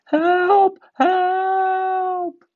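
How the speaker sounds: very high, loud cry for help; runaway engine crying help. A narrator's reading. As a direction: scream it out in panic.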